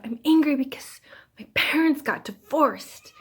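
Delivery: loud, high imitation of an angry child; retold child screaming. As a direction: act out a hurt little girl's outburst. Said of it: "I saw her screaming".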